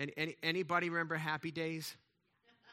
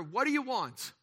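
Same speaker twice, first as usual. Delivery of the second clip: high, loud bear voice; 8 semitones higher, 5 dB louder than usual. Acted. Bear asks hunter in joke.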